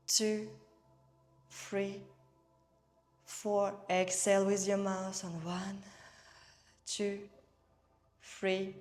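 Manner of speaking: unhurried meditation guide, evenly paced; guided meditation. Real, not acted. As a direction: guide them gently through each breath, leaving space. Leads breathing and guided meditation.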